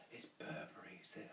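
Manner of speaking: hushed gossipy question; very soft, imitated nosy whisper; confidential whisper. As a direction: whisper the brand name like a secret. Whispered gossip about luggage, retold.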